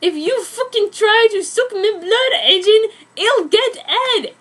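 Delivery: high, loud mock threat; hammed-up shrieked threat; scream. A narrator's reading. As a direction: scream it like an angry teen heroine. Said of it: "I screamed".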